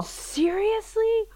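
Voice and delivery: very high, slow, stretched out; incredulous. Real, not acted. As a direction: drag it out in shocked disbelief. Disbelief she didn't taste.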